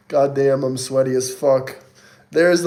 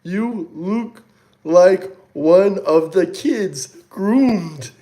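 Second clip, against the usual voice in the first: slow reading; 4 semitones higher, much slower than usual. Real, not acted. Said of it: "I can't read".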